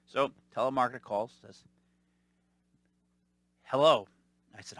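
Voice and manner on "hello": mock phone hello. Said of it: "it wasn't a Lenny Squiggy".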